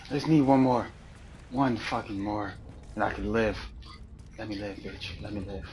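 a hint of addict pleading, fading very soft; mock pleading that trails down under the breath; desperate addict. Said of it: "I sound like a fucking crack addict".

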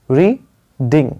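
two separate syllables, री then ding, with a clear gap. Shown as a demonstration. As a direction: break reading slowly into री … डिंग. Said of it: "ये देखिए".

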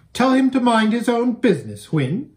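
high, loud impatient command; impatient order. Acted. Girl ordering her mare to hush, high and impatient. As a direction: shut it down now. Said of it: "said the girl".

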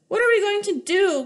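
a mother's cry of distress. Acted. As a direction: cry out in alarm. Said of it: "cried Missus Cream".

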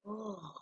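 very soft, melting sigh of comfort; blissed-out sigh. Retold or imitated. Under a comfy blanket, a blissful sigh. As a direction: sink into it and exhale. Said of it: "And you're like".

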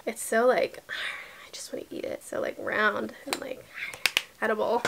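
soft breathy whisper with a sigh; awed whisper. Real, not acted. Admiring a round complexion stick, awed whisper. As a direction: sigh it out, almost to yourself.

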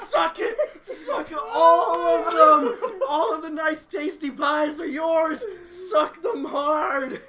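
high, loud, laughing taunt; gloating laughing shout. Real, not acted. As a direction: crow over the losers, cackling. Gloating over his win.

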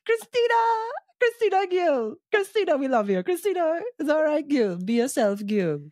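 very high excited calling out of Christina; cheering. Real, not acted. Cheering Christina on like a fan, high and excited.

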